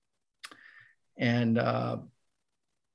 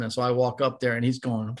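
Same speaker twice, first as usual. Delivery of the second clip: normal talking voice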